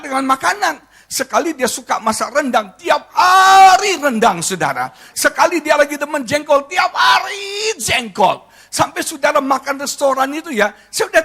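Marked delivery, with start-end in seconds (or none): 2.8-4.4 "hari" drawn out long, high and loud; comic exasperation. Acted. Husband moaning about rendang every day, "hari" stretched in mock exasperation. Comic exaggeration of endless repeats. 6.7-8.4 "hari" drawn out long and high; comic exasperation. Acted. Husband moaning about jengkol every day, "hari" stretched in mock exasperation. Comic exaggeration of endless repeats.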